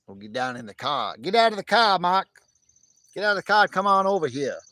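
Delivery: loud, high hollered Boston accent, building from the first call. Shown as a demonstration. Streamer showing a Boston voice to viewers, loud Boston accent. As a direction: holler with dropped Boston r's. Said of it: "Boston accent".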